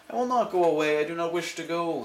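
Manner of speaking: cheeky cat. Acted.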